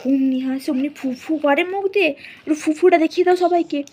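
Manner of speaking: very fast eager chatter; quick coaxing. Real, not acted. Mother rapidly urging her baby to blow raspberries for everyone. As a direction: rattle it off excitedly.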